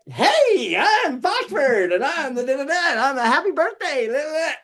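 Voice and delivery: high, singsong puppet birthday pitch; Foxford puppet voice. Acted. [name] pitches Foxford to the station owner, in a high singsong puppet voice. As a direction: chirp it like a kids' TV puppet. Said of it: "And I'm like".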